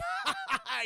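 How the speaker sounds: high imitated cackle; mock derisive laugh. Intruder mocking the helpless kid, derisive laugh.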